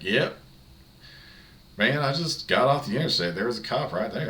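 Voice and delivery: a hint of a Tennessee local; Tennessee twang. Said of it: "a little bit of a twang".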